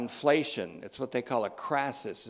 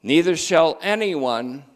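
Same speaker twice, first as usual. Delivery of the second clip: louder, higher scripture declaration; emphatic proclamation; 5 semitones higher, 9 dB louder than usual. Real, not acted. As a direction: proclaim it to the congregation. Preacher proclaims the verse.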